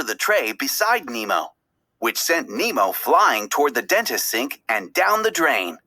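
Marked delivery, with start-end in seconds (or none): none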